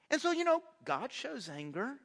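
ordinary talk, nothing marked

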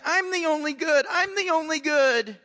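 high, loud mock tantrum; insistent, petulant mock God; pouty tantrum. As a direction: whine it like a pouting child pitching a fit. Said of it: "don't hear God say that with a pouty face".

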